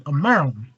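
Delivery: "Maryland" run into two syllables like "Merlin"; Baltimore-style "Maryland", squeezed to "Merlin"; local Maryland pronunciation. Retold or imitated. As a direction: say "Maryland" the local way, as "Murr-lun". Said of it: "We say Maryland".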